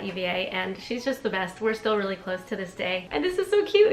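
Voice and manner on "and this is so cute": gushing. Real, not acted. Gushing over roommate entry.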